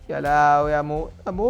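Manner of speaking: slow, drawn-out wail; comic lament. Pretend. Player about to die in game, drawn-out mock lament. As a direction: stretch the last syllable into a long held moan.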